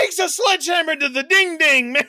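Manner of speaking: high, shouted disbelief; loud, high-pitched comic excitement; gleeful outburst. Real, not acted. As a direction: burst out with the absurd highlight. Gleefully recounting slapstick climax.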